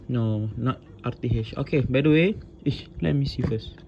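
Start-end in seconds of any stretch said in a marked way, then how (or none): none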